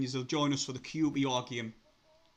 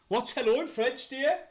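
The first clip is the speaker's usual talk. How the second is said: mock posh; 10 semitones higher, 5 dB louder than usual. Teasing chat about French.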